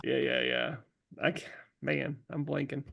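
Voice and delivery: ordinary talk, nothing marked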